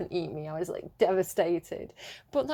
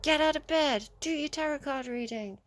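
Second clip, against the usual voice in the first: higher voiced crows nagging her awake; voicing the crows; 6 semitones higher than usual. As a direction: play the cawing crows bossing her out of bed. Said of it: "They were like".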